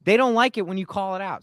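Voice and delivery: real frustration at people in power; emotional. Real, not acted. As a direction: let the anger rise. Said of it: "I get emotional about this shit".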